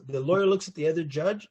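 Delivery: ordinary delivery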